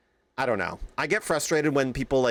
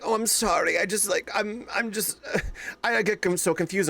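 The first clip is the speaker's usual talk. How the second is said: lightly mimicked flustered customer, higher voice; confused fake-French customer; 6 semitones higher than usual. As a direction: stammer a mock apology as if confused. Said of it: "pretended to have a French accent. And she pretended to get in-, confused about the money".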